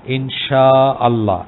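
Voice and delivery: ইনশাআল্লাহ said very slowly, ইনশা stretched long and the hamza sounded apart; correct Arabic pronunciation. Shown as a demonstration. Teacher showing students the right ইনশাআল্লাহ, slow and careful. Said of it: "আসলে বলা উচিত".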